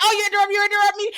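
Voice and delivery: high, fast mock whine; mocking whiny impression. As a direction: squeak it out like a petulant man-child. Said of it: "he'd be telling her that".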